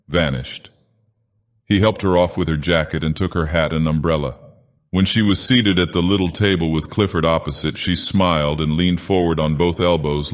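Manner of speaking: usual speaking voice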